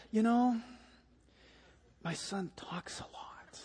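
hushed, slow whispered aside; confiding father. Retold or imitated. Father warning his seatmate about his son, confidential whisper. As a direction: drop to a near-silent whisper, imitating the dad.

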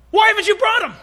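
high, loud demand; indignant demand. Acted. Pharisees rounding on the guards, loud and indignant. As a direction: rage at the empty-handed guards.

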